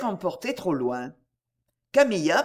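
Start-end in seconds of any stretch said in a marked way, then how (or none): none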